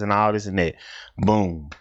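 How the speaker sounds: ordinary talk, nothing marked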